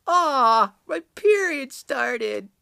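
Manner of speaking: high, loud, slow mock whine from the wife; imitating wife. Wife complaining from the bathroom before her trip, high mock wail. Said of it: "I hear her in the bathroom".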